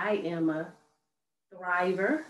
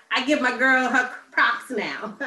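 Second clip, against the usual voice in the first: louder, laughing as she gives her props; laughing praise; 7 semitones higher, 7 dB louder than usual. Real, not acted. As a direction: laugh it out proudly. Host laughs, praising guest.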